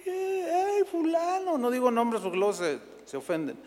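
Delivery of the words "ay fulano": high, tearful mock fan cry; hammed-up high fan wail; mock fan screams. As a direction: imitate fans wailing for their idol. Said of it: "y gritaban".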